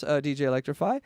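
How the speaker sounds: ordinary talk, nothing marked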